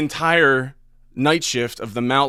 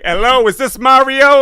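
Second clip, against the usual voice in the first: much higher, loud, goofy caller voice; put-on prank caller voice; 14 semitones higher, 12 dB louder than usual. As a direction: announce yourself as a stranger. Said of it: "I had all these voices".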